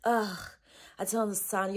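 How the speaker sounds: usual speaking voice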